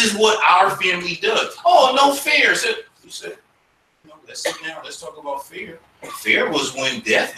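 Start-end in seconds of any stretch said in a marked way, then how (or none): 1.6-2.5 put-on whiny kid. Kids complaining in acted scene. 4.1-5.8 calm parent. Acted. Parent calming kid, acted.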